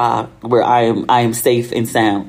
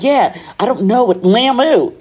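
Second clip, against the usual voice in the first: put-on high, teasing take on an American accent; exaggerated American accent, pitched up; mocked American accent; 9 semitones higher than usual. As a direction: poke fun at the American "Lamu". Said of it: "She was making fun of my American accent".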